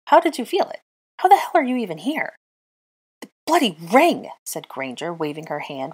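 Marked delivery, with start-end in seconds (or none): 0.1-2.4 high, loud baffled questioning; incredulous. A narrator's reading. Flyer questions the witch who felt his fall, incredulous. 3.2-4.3 high, loud exasperated retort. A narrator's reading. Witch waves the ring in his face, exasperated.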